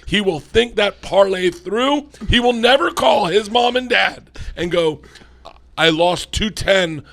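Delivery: loud, high-pitched comic tirade; emphatic rant. Real, not acted. Comedian vowing the kid will never bet badly, emphatic rant. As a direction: build to a loud, insistent proclamation. Mock-triumphant rant about the lesson taught.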